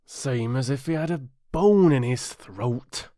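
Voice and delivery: lightly voiced Griffin, brisk; a hint of a creature, cutting in; gruff Griffin. Griffin's voice in the story.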